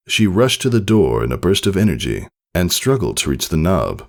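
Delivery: ordinary delivery, nothing marked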